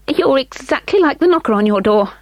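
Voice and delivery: put-on a small boy's polite voice. A narrator's reading. William voiced.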